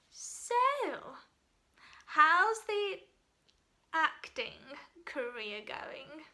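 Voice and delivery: slow, drawn-out stress on "acting"; condescending stress on ACTING. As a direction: lean on "acting" as if it were some glorified hobby. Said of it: "Emphasis on the word acting".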